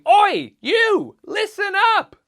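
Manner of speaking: loud, high "oi" to grab attention; pirate attention call. Shown as a demonstration. Pirate showing what avast ye means, high loud call. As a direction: holler at someone to listen. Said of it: "I'm saying".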